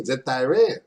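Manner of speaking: ordinary talk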